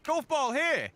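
high, eager calling out; pointing it out with glee; excited. Real, not acted. Showing his friend the golf ball, excited.

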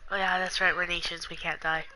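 normal talking voice

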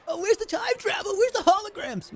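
high, whiny letdown voice; complaining to the puddle alien, mock whiny; disappointed human. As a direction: whine about the missing future tech. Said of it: "This is gonna be so disappointing".

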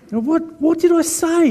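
high-pitched confused what; mock disbelief, up high; baffled surprise. Retold baffled reply.

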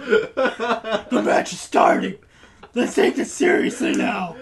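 rasping, stern superhero announcement; Bat Dad. Acted. Friend calls team to order as match starts, Bat Dad voice. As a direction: rasp it out as a serious hero. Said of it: "I was doing Bat Dad".

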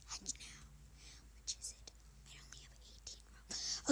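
hushed unvoiced whisper to herself. Real, not acted. Whispering while hatching an egg in the game. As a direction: breathe the words with no voice at all. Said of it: "why am I whispering?".

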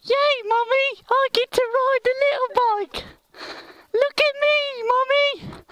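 put-on very high falsetto child calling to Mummy; put-on squeaky kid, far above your own pitch; little kid voice. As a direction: squeal like an excited small kid showing off. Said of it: "I'm such a big kid".

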